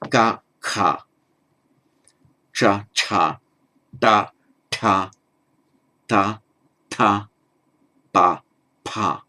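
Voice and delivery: ga then ka: each pair plain then breathy; aspiration contrast. Shown as a demonstration. Teacher drilling the distinction in pairs, carefully. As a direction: alternate unaspirated and aspirated syllables. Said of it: "make that distinction".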